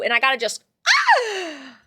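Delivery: sudden mock ah, cut short; playful yell. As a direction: let the feelings out in a mock yell. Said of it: "a lot of feelings inside of my body".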